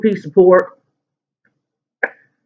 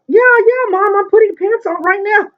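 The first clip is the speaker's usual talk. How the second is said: flustered high. Retold or imitated. Flustered excuse to mom.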